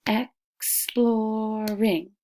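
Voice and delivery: Exploring stretched slowly, beat per syllable; slow sounded-out Exploring. Shown as a demonstration. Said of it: "do it together".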